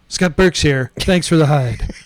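lightly imitated low-pitched sign-off; low, understated imitation of a colleague; Scott Burks. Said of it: "That's the way Scott does it".

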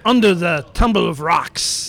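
put-on lightly French-accented reading; French character voice. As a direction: read it with a fake French accent. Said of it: "another voice we can't understand".

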